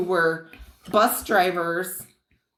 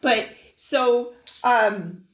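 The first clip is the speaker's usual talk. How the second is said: usual speaking voice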